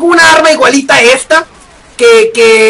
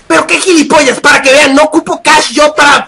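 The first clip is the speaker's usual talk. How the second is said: ordinary delivery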